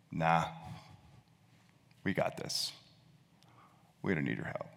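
dismissive, half-whispered brush-off; defiant sneer. Retold or imitated. Humanity to God, defiant sneer. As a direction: wave off the help with quiet scorn. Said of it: "with kind of a defiant sneer and says".

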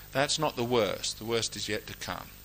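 ordinary talk

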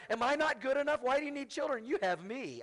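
mock a husband asking why she needs kids; mocking clueless husband. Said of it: "He's like".

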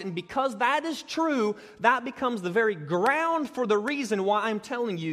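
impassioned preaching. Acted. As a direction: preach it, lifting and pushing the appeal. Preaching at rising force.

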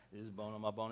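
low, very soft throwaway reading; boring read. Shown as a demonstration. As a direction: mumble it dully as rote recitation. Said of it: "it sounds kind of boring".

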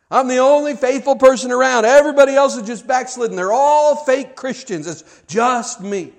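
loud, high, wailing self-pity; self-pitying lament. Acted. Preacher voicing Elijah's self-pity, loud and high. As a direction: whine it big like a martyr. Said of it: "That's how I felt".

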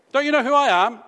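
pompous shout: who do they think I am; mock entitled outburst. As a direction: demand it like a pompous celebrity, loud and high.

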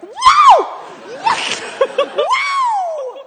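high, loud whoops broken by laughter; ecstatic whoops. Retold or imitated. Getting in the car after the visit, ecstatic laughing whoops. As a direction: whoop with joy, laughing between. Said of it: "I'm like".

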